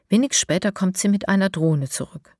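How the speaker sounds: ordinary delivery, nothing marked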